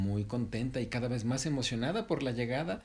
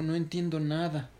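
higher-pitched bewildered protest; confused heron; 7 semitones higher, 4 dB louder than usual. Acted. Confused heron answers monkey mother, bewildered. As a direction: sound lost, pitched up. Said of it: "estaba muy confundida".

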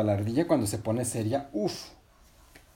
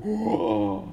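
high "oh" of wonder; acted high-pitched amazement; surprised gasp; 9 semitones higher, 3 dB louder than usual. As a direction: gasp as if spotting something amazing. Patinina reacts on seeing something.

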